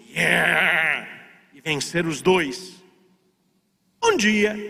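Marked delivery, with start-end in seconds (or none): 0.1-1.1 long, held mock cheer; soldiers' cheer. All the soldiers answer their captain, imitated cheer. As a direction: imitate a troop cheering back.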